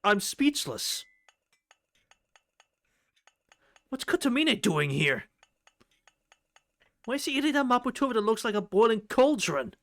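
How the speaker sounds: loud, high astonishment; incredulous. Real, not acted. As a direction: marvel out loud in disbelief. Stunned reaction to the scene.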